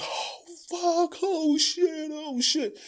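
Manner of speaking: high, slow drawn-out panic; panicking after hitting the parked car; panicked. Retold or imitated. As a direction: wail it out in mock panic. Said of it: "and I was".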